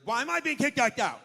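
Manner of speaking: high, loud indignant whine; woman being kicked out, protesting loudly; whiny protest. Retold or imitated. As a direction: complain shrilly while being thrown out. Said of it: "she was very vocal".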